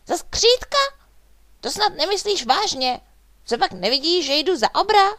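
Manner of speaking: high, loud indignation; outraged, high-pitched shouting; furious. A narrator's reading. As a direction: burst out in offended disbelief, pitched right up. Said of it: "Bonifác vztekle zavrtěl hlavou".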